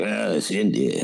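lightly voiced low sidekick; a hint of the old movie sidekick; lightly imitated film sidekick; Indy sidekick voice. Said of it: "you know".

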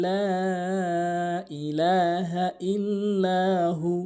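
slow, drawn-out chanted recitation; Quran recitation. Shown as a demonstration. Teacher reciting the verse slowly for students.

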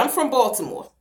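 usual speaking voice

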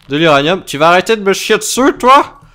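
real mock-annoyed rant; exasperated scold. Real, not acted. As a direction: scold the pest. Angry at butterfly.

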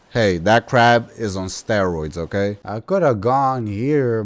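ordinary talk, nothing marked

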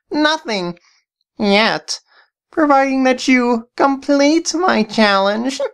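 high, sly villain voice; evil voice guy. Acted. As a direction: scheme like a cartoon baddie. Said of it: "why did you call me evil voice guy".

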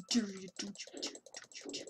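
sinking to a whisper; quiet fading threat. Real, not acted. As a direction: let the threat die to a whisper. Fading threat after shouting.

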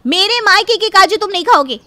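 loud, insistent scolding; wife demanding her cashews back from her husband, loud; angry demand. Acted. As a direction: demand it all back, loudly. Wife keeps fighting husband.

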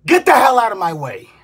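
normal talking voice

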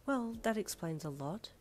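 soft, thoughtful mum; quiet, pondering remark; musing. Acted. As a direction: say it gently to yourself. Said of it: "she mused".

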